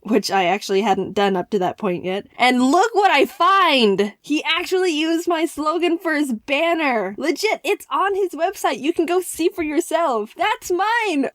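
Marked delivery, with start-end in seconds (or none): 2.4-4.1 loud, slow build to the reveal; excited reveal. Real, not acted. Telling viewers of the website: loud, drawn-out excited reveal. 4.2-11.3 higher and higher with delight; thrilled. Real, not acted. Showing viewers her slogan: high, thrilled gushing.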